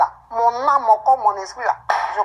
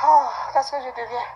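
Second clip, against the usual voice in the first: usual speaking voice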